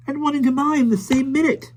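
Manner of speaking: put-on breathless, panting girl; out of breath. A narrator's reading. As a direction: gasp it out after running. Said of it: "Jane panted behind him".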